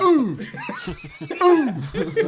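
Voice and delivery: high, loud cartoon starfish yelp; Patrick yelp. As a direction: squeal like a hurt cartoon starfish. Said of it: "you hear is Patrick going".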